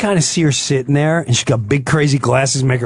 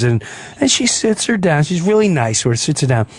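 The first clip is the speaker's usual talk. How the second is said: ordinary talk, nothing marked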